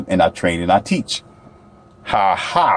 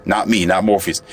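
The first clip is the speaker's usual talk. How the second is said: normal talking voice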